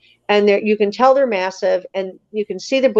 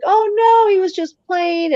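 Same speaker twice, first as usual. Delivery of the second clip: reassuring; 10 semitones higher than usual. Retold or imitated. Woman to crying kids after the charge, reassuring. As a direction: play it down for scared children, pitched way up.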